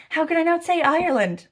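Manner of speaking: loud, high-pitched rhetorical question; emphatic exclamation. Real, not acted. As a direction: exclaim it as if it's utterly obvious. Mock-indignant rhetorical question about her pick.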